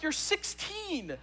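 high, drawn-out mock disbelief; retold incredulity. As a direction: stretch it out, astonished at such childishness.

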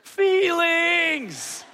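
put-on high, whiny teary child voice; mock hurt child. Daughter telling parent he hurt her feelings, mock teary. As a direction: play the wounded little girl with a laugh. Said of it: "daughter crying, hurt feelings".

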